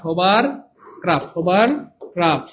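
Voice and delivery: slowly spelled out; slow drill. Shown as a demonstration. Teacher slowly drilling the word hovercraft for students. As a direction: drill each syllable slowly for the class.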